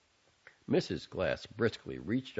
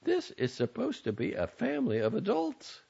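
high, grim, disapproving mother; mother grumbling about the unwashed basin; 14 semitones higher than usual. A narrator's reading. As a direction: complain pointedly to the whole household. Mother reproaching.

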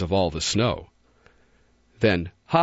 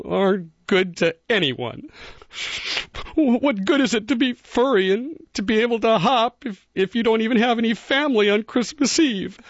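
crying bunny; 12 semitones higher than usual. A narrator's reading. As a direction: sob it out as a lonely little rabbit. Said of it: "Barrington cried and cried".

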